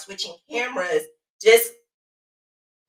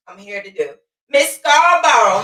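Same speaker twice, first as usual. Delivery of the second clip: ordinary talk, nothing marked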